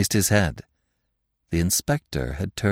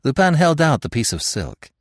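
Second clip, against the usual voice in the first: ordinary delivery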